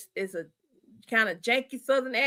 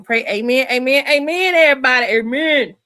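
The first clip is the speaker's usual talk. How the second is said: rousing amen; 2 semitones higher, 8 dB louder than usual. Real, not acted. Closing the prayer for everybody, loud rousing amens. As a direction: lift the room with each amen.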